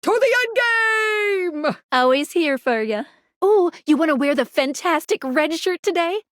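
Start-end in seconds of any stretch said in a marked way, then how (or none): 0.0-1.8 high, long-held heroic call; rallying cry. Acted. Leading the player to the end game, rallying cry. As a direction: hold the last word high like a charge.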